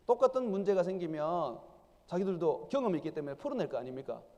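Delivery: ordinary talk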